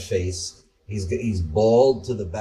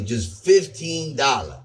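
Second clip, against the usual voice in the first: slow, higher drawled price call; Southern pitchman price; 5 semitones higher than usual. Retold or imitated. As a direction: say the price like a folksy shop ad. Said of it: "He goes".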